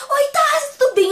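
high, loud voice breaking into tears; emotional teary greeting. Retold or imitated. Fan meeting her idol, crying with emotion. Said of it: "super emocionada".

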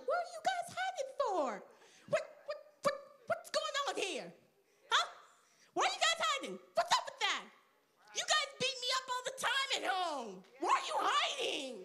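put-on very high, squeaky boy voice; bewildered young David. Young David asking his brothers why they hide, high and baffled. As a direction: sputter questions in a high kid voice.

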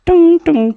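high-pitched toy cart jingle sound; playful "tung tung" noise. Pretend. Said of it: "चलो हम लोग टुंग". (ऊँची आवाज़ में टुंग-टुंग की नकल)

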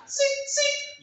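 very high squeaky rat voice; rat squeak. Acted. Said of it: "dando gritos de rata".

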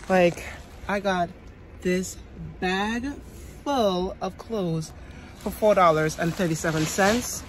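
normal talking voice

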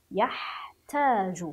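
يحتاج split slowly as yah, pause, taaju; syllable-by-syllable reading. Shown as a demonstration. Teacher models reading, يحتاج slowly in parts. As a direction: stretch both parts of يحتاج. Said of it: "من يقرأ هاتين الكلمتين".

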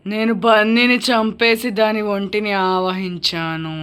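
slow, drawn-out spooky drone; possessing ghost. Pretend. Ghost tells family it possessed Bunny, slow and eerie. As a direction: intone it like a haunting spirit.

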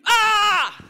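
high mock shriek of terror; hammed-up scream falling into the den; retold scream. Joke gorilla man falling into the lion's den, mock scream. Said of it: "he started screaming".

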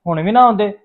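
rising resentful rant at the father; bitter outburst. Acted.

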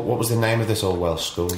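ordinary talk

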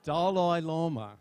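"Dalai Lama" with a Tibetan-style accent, lightly mimicked; imitating the accent. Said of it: "that great accent".

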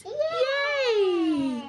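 high bright cheer; cheery yay. Real, not acted. Mother cheering her happy baby, high and excited. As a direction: cheer him on.

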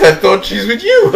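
laughing through a mock-sincere quote. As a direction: try to sound earnest but crack up. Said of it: "He sincerely says".

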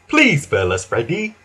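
ordinary delivery

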